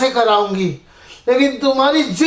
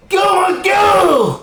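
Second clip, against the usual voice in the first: higher, shouted why; anguished cry; 5 semitones higher than usual. Acted. Son shouting at mother who poisoned him. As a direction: cry out in betrayal.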